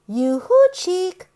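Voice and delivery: ordinary delivery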